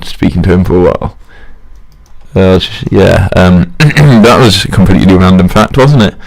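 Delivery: ordinary talk, nothing marked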